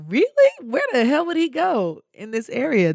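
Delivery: high-pitched incredulous outburst; shocked disbelief. Retold or imitated. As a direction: imitate a friend who can't believe it. Girlfriend's disbelief, retold.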